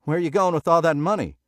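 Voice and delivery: loud, high, fast shout across the room; calling after. A narrator's reading. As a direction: call after him across the casino. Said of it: "The stickman called after him".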